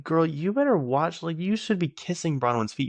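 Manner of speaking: high-pitched, sassy scolding; indignant telling-off. Acted. Speaker imagines scolding Bronwyn's mean mother, high indignant telling-off.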